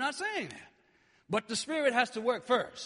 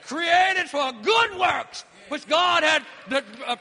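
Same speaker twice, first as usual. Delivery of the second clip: preaching at full force; 4 semitones higher, 6 dB louder than usual. Acted. Shouted preaching.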